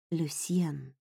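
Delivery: hushed, low murmur of a name; whispered. A narrator's reading. Heroine whispers her beloved's name beneath Marcel, hushed. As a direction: breathe the name out softly. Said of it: "прошептала я".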